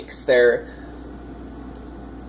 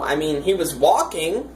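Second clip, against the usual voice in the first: ordinary talk, nothing marked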